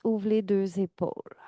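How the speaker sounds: ordinary delivery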